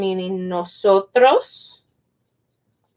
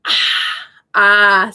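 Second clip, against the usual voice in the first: after-drink "ah"; 2 semitones lower, 3 dB louder than usual. Shown as a demonstration. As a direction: open "ah" as after a drink of water. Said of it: "you drink water".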